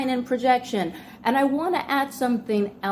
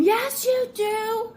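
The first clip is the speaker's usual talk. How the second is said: mock scream; 12 semitones higher, slower than usual. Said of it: "And they might scream".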